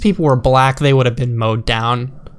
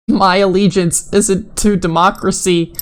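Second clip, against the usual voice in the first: higher, mocking whiny-devotee voice; mocking zealot voice; 4 semitones higher than usual. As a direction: sneer it like a fanatic. Mocking quoted zealot tweet.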